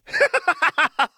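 high wild cackling laugh; uproarious manic laugh. A narrator's reading. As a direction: throw back your head and cackle. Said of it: "Jinx laughed uproariously".

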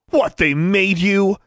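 high, slow incredulous demand. A narrator's reading. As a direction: demand it in scornful disbelief. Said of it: "Alexandra demanded".

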